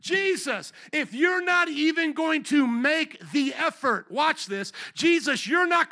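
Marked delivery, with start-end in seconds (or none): 0.0-3.2 higher, louder, slow alarmed cry; panicked Peter. Retold or imitated. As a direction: freak out as Peter. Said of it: "Peter's like".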